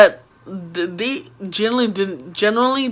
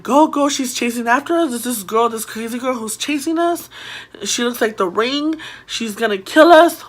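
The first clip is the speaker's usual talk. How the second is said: higher-pitched urgent pleading; retold panic; 4 semitones higher than usual. Kids telling parents about the chasing girl, panicked. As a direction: act out frightened kids explaining in a rush.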